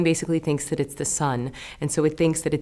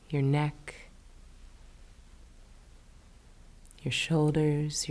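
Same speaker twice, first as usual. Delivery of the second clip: soft, unhurried meditation voice with long pauses; guided meditation; 5 dB quieter than usual. Acted. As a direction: lull the listener gently toward sleep, hushed and spacious. Leads a bedtime body scan.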